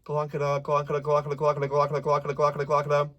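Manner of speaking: "glonkada" repeated quickly and evenly, tongue doing the work; fast tongue twister. Shown as a demonstration. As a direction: rattle off "glonkada" over and over. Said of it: "Look at how much my tongue is moving".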